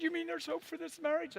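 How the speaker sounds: high-pitched imitation of his wife; wife's voice. Wife asking husband if the marriage has hope, high imitated voice. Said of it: "She said".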